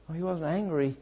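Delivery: ordinary talk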